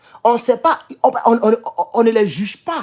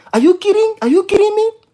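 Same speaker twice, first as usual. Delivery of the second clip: high-pitched, indignant mock disbelief; acted incredulous protest; 9 semitones higher, 3 dB louder than usual. Driver replaying his retort to police, incredulous. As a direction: throw the question up high, as if offended.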